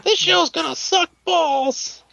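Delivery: put-on very high, slow childlike whine; disgruntled Tiny Tim. Mocking an upset fan with a childish whine. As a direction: whine like a sulky little boy. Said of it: "Sounds like a disgruntled Tiny Tim".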